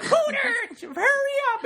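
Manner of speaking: high, loud, frantic shout; panicked yell. Retold or imitated. Robot shouting at Hooter to hurry, panicked and high. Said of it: "he's like".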